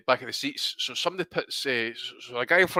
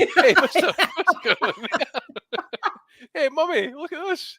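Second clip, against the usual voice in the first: put-on high, bright little kid piping up; 3 dB louder than usual. Mocking the kid walking past the plane seats, high child voice. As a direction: play a nosy eight-year-old, pitched way up. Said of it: "some eight-year-old kid walking by".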